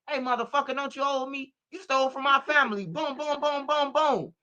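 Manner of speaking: high cartoon threat; mock cartoon character. Parodying new cartoons threatening people, high character voice. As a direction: do a high little cartoon tough guy.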